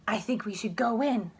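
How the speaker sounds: hushed whisper, scheming. Acted. Sheila whispering to Michael, a secret whisper. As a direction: breathe it like a secret plan.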